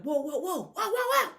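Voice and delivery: high, loud rising whoas; imitating a fan jolted by a sudden big play; excited fan whoa. Fan watching the sudden swing in the game, excitedly whoaing. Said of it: "you may be like".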